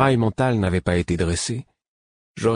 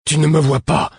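higher and much louder, a booming order; thundering command; 8 semitones higher, 7 dB louder than usual. A narrator's reading. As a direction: roar it as a mind-blasting command. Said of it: "Son art tonna dans sa voix".

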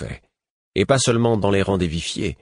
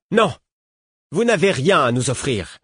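raised, higher outburst; exclaiming; 8 semitones higher, 3 dB louder than usual. Acted. Rebel woman exclaiming at the queen. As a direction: fling the refusal out. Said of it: "s'exclama la femme".

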